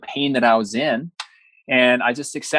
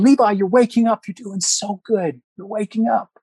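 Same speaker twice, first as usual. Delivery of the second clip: high-pitched imitation of an excited wife; calling out encouragement; 10 semitones higher than usual. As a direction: imitate calling someone back awake, much higher. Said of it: "this voice calling out".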